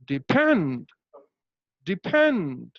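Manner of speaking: "depend" with a long, falling PEND after a short DE; stress on PEND. Shown as a demonstration. Teacher modelling syllable stress, "depend" stressed on PEND. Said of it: "how to stress upon this syllable".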